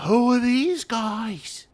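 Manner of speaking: high, drawn-out amazed goblin; stretched, high-pitched astonishment; wide-eyed goblins. Acted. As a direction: gawp at the newcomers in a squeaky slow cry. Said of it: "their eyes get big, and they're like".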